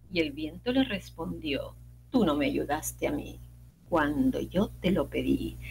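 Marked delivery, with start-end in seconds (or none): 2.1-5.6 cold wind voice. Acted. Wind refusing the chick, low cold voice.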